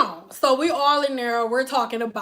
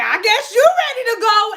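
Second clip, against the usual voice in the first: loud pointed reply; 9 semitones higher, 5 dB louder than usual. Retold or imitated. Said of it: "she was like".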